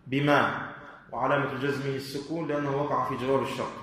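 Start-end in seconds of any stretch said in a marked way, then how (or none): none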